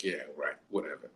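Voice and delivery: low, quiet, unimpressed brush-off; deadpan eye-roll reply; dismissive. Retold or imitated. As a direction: mutter it like you don't buy a word. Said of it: "I was like".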